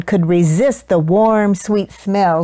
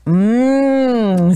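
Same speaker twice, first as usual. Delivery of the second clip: long, drawn-out "mmm" of delight; savouring hum. A narrator's reading. Duchess tasting her sweet roll, long savouring hum. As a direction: relish the taste with a slow hum.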